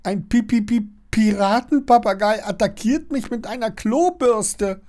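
high-pitched incredulous stutter; dumbfounded high boy's voice; stunned stammering. A narrator's reading. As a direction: trip over the first syllable in disbelief. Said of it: "stammelt er immer noch fassungslos".

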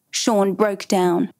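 ordinary delivery, nothing marked

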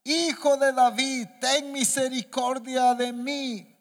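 high, loud, drawn-out plea; crying out harder. A narrator's reading. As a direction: stretch each word into an insistent call. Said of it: "Pero él clamaba mucho más".

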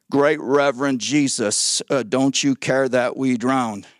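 mock-reverent, slow and low address; Pastor Peter. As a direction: pretend an unhurried, over-polite plea in a low voice. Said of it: "Pastor Peter came over and said".

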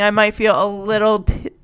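ordinary delivery

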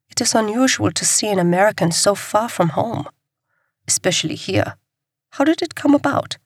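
a hint of a foreign resistance agent; light voicing of a guarded contact; lightly voiced foreign contact. Resistance contact questioning the American, lightly voiced.

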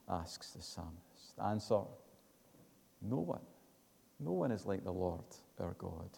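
normal talking voice

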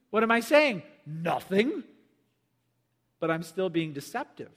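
normal talking voice

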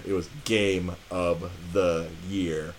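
slow, weighty pronouncement; emphatic verdict. Real, not acted. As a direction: draw out each word of the verdict. Emphatic verdict closing the story.

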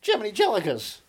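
campy old-hero enthusiasm, lightly put on; lightly imitating Adam West. Continues acting out Adam West.

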